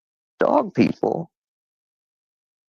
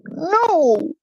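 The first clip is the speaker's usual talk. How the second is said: much higher mock dog yelp; dog saying no. YouTube dog refusing its owner, high yelped no. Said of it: "and the dog went".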